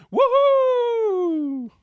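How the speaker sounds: high, loud whoop; cheering. A narrator's reading. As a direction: celebrate the money. Bach celebrates money.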